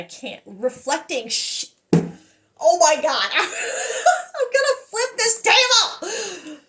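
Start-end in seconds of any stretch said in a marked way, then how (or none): 1.9-6.6 high, loud, drawn-out squeal through laughter; giddy, slow and high-pitched exclaiming; overexcited outburst. Real, not acted. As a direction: burst out laughing in thrilled disbelief. Overwhelmed reaction to a card.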